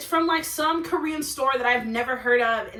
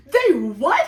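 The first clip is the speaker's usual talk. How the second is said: loud, laughing disbelief; acted stunned question; incredulous shock; 7 dB louder than usual. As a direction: blurt it out, astonished. Acted shock at hacked phone.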